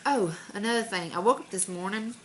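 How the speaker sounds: normal talking voice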